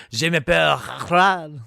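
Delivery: hammed-up French accent, playing the robot car. Friend mocks the movie robot in exaggerated French accent. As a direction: lay on a thick mock French accent. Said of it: "French accent".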